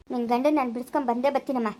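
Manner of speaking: ordinary talk, nothing marked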